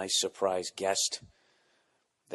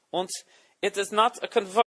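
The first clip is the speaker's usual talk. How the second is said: high, loud put-on European accent; mock foreign accent; 13 semitones higher, 6 dB louder than usual. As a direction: lecture like a pedantic foreign techie.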